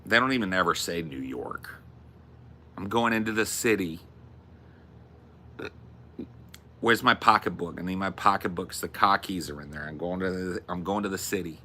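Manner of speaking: put-on Jersey accent; hammed-up New Jersey speech. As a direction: talk like a Jersey local. Imitating New Jersey locals' talk.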